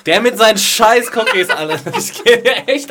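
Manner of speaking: high, loud, fed-up outburst; exasperated rant. Real, not acted. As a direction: vent about the brat. Ranting about annoying child.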